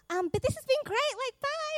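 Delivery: high, loud forced-polite goodbye; a friend escaping the chat; awkward exit. Retold or imitated. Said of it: "one of the girls is like".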